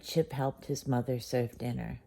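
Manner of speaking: ordinary delivery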